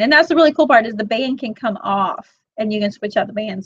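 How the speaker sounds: normal talking voice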